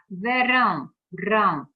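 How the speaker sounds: "Verão" loud, then "rão" punched alone; stress on RÃO. Shown as a demonstration. Teacher showing the strongest syllable, loud emphatic "rão". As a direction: hit "rão" in "Verão", then repeat it loudly. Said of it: "a sílaba mais forte".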